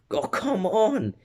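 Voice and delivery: high, drawn-out mock groan of disbelief; exasperated protest. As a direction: whine it in put-on exasperation. Acting out exasperated classmates.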